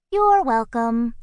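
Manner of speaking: perky bird voice. A narrator's reading. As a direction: chirp it out politely. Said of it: "said Kalinka".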